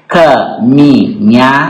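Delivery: "Caminhada" slowly split as ca-mi-nha-da; syllable by syllable. Shown as a demonstration. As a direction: count out four separate syllables in "Caminhada". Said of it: "quatro sílabas". ("Caminhada" pausado, sílaba por sílaba)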